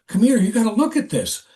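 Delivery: high, eager call to come look; urgent summons. Retold or imitated. Coworker calls the narrator over, high and urgent. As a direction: pull him in, excited. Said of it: "He goes".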